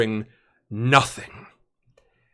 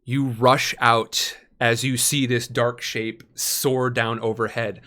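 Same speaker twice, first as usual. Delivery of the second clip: ordinary talk, nothing marked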